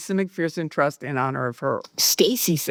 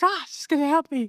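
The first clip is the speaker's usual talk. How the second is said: acted-out elation; 9 semitones higher, faster than usual. Alone, seeing Stacy's email arrive, giddily hopeful. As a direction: re-enact breathless hope in a high voice.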